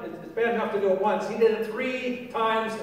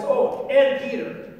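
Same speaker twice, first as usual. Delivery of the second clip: AND high, loud and stretched before Peter; stress on AND; 6 semitones higher, 7 dB louder, slower than usual. Acted. Preacher asks why the angel named Peter, stress on AND. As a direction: punch "and" like a pointed addition.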